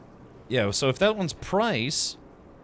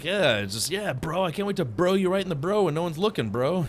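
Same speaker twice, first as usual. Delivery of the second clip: mock frat bro; 6 semitones higher than usual. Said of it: "he's like".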